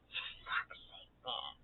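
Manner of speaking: breathy under-the-breath curse; whispered curse. Real, not acted. Annoyed at the wrong canvas, whispered swear. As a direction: hiss it to yourself in frustration.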